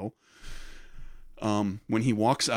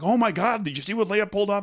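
mock amazement; 9 semitones higher than usual. Mimicking amazed reaction of everybody.

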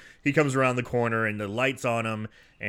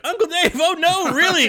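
put-on much higher, louder panicking boys; panicked kid voice; 7 dB louder than usual. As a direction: yelp it like frightened kids. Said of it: "the two butt boys are like".

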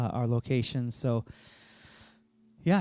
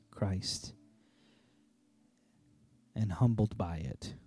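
earnest, hushed closing prayer. Acted. Pastor leads closing prayer.

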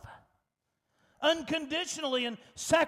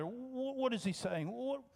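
ordinary delivery

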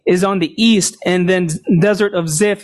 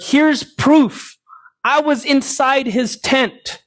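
higher-pitched imitation of yelling across; mock calling out; 5 semitones higher, slower than usual. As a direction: announce it high, as if calling over. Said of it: "while he's yelling out to Abner".

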